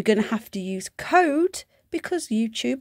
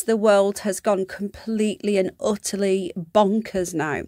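ordinary talk, nothing marked

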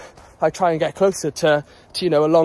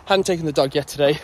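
usual speaking voice

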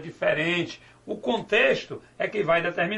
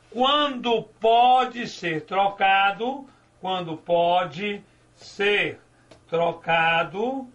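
slow emphatic rule; slower than usual. Shown as a demonstration. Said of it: "teacher stresses the rule".

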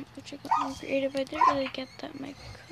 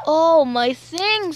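loud; 2 semitones higher, 14 dB louder, slower than usual. Real, not acted. Dismay at his inventory.

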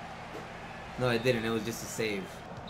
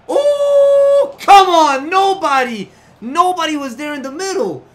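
frustrated outburst; 10 semitones higher, 10 dB louder, slower than usual. Real, not acted. Nobody ran into the box.

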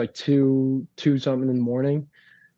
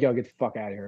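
softer, toned-down retold brush-off; less aggressive; 3 semitones lower, 5 dB quieter, much faster than usual. Older brother shooing the kid from practice, milder. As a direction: wave them away without real aggression. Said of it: "so aggressively, but he'd be like".

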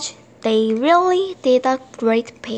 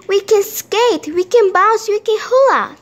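high, loud, joyful listing; thrilled storybook character, pitched well up; excited; 9 semitones higher, 5 dB louder than usual. A narrator's reading. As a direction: reel off the fun with glee. Simon rejoices, sad list now happy.

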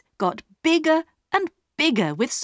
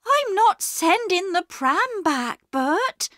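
high-pitched, determined refusal; firm wife; 11 semitones higher than usual. A narrator's reading. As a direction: put your foot down in a high character voice. Wife firmly refuses her husband.

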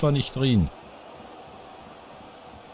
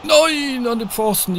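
dismayed shout; 11 semitones higher, slower than usual. Real, not acted. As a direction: yell no as the shot hits the post. Shot hits the post.

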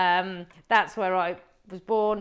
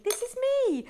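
insistent. Real, not acted. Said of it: "I'm not putting this on".

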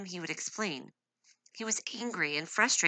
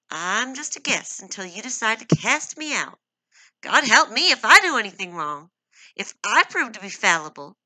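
higher, much louder sarcastic protest; bitter outburst; 6 semitones higher, 12 dB louder than usual. Acted. Wife bitterly protesting to her husband, loud and angry. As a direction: fling the words at him, wounded. Miriam hurt and furious.

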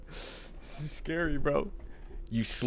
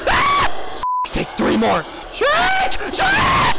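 high panicked shouts; frantic yelling; much slower than usual. Real, not acted. Player yells at Shrek during chase, frantic. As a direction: yell in panic.

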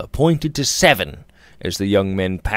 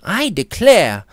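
higher, slow and exasperated; impatient; 9 semitones higher, 4 dB louder than usual. A narrator's reading. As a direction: huff with impatience. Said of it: "said Alicia impatiently".

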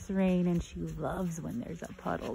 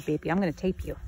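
normal talking voice

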